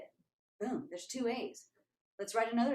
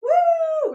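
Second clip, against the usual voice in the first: loud, very high whoop; happy whoop; 16 dB louder than usual. Retold or imitated. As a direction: let out a jubilant "woo". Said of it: "We were so happy".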